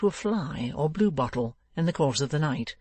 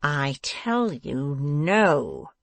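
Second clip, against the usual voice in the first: slow, emphatic refusal; domineering dwarf; angry cry; much slower than usual. A narrator's reading. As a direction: draw it out, landing hard on the no. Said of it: "cried the dwarf".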